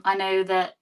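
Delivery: normal talking voice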